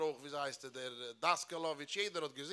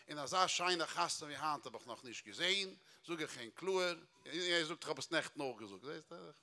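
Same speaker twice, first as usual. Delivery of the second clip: usual speaking voice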